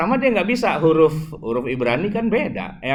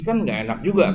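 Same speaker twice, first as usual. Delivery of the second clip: ordinary talk, nothing marked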